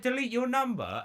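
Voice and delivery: high, incredulous angry yell; incredulous shout. Retold or imitated. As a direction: throw the words back in disbelief. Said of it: "so fucking pissed off".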